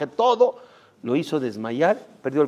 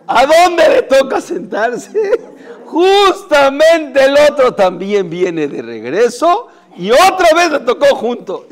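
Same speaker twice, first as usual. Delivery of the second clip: laughing loudly through the words; 10 semitones higher, 9 dB louder, slower than usual. Real, not acted. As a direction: tell it while cracking up. Laughing retelling of the coincidence.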